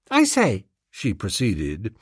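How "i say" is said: put-on very high child's exclamation; excited schoolgirl. Schoolgirl about to share a secret with the valet, excited. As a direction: pipe it up as a girl with news.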